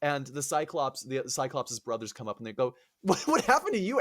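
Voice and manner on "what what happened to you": high-pitched mock bewilderment; brothers' startled question. Cyclops' brothers finding him hurt, high bewildered query. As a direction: ask it in comic alarm.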